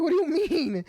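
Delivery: high, startled question; baffled. Retold or imitated. Son confused by mom's alarm, high baffled question. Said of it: "I'm like".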